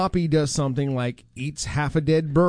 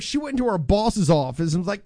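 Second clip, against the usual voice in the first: ordinary talk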